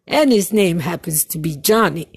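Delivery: ordinary talk, nothing marked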